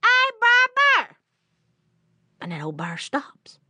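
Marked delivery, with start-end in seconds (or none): 0.0-1.2 high, loud, drawn-out call; hollering. Acted. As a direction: holler to someone far down the road. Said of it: "he hollers again".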